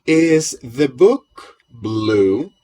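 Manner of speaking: "Is John busy?" asked very slowly and clearly; slow drill question. Shown as a demonstration. Teacher asking the class a practice question, slow. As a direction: spell it out word by word for the class.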